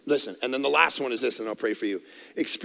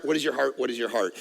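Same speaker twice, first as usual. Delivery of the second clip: much softer, lower repeat; fading echo; 9 semitones lower, 8 dB quieter, faster than usual. Acted. Pastor echoing the question softly, fading. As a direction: let the question die away to a hush. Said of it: "repeated question, fading".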